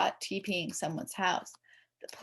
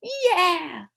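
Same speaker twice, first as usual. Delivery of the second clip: excited cheer; 11 semitones higher, 7 dB louder than usual. A narrator's reading. Kids cheer the prank idea.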